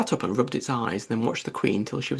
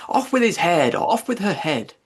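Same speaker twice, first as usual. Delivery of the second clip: Queen shouting; 4 semitones higher, 4 dB louder than usual. A narrator's reading.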